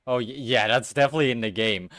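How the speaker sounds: ordinary talk, nothing marked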